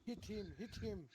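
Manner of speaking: play-shouting in character; mock shout. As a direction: urge someone on with a contained shout. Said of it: "and I shout".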